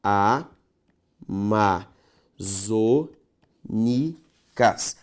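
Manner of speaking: Amazônicas spelled out very slowly, syllable by syllable; teacher splitting the word for the class; syllables pulled apart. Shown as a demonstration. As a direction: stretch out every syllable of Amazônicas. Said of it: "Separe comigo".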